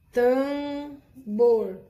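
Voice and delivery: teacher holds up an example word for the students; example word emphasized. Shown as a demonstration. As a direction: spell out tambor loudly for the class. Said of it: "Três palavras com a vogal mais M".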